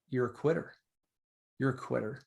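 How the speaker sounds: mild mock devil taunt; devil in the ear. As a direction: lightly voice a whispering tempter. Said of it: "the devil in the ear going".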